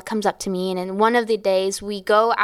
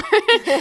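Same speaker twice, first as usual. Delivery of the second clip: laugh; 13 semitones higher, 4 dB louder than usual. Real, not acted. Laughing while retelling mom story.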